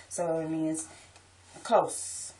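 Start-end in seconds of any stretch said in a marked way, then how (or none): none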